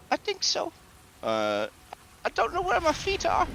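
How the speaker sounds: very high, dazed skeleton. Acted. Fallen skeleton tells the hostess it lost its feet, very high.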